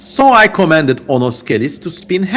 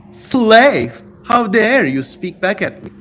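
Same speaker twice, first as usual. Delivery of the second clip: angry rebuke; 7 semitones higher, slower than usual. A narrator's reading. King rebuking bound demon.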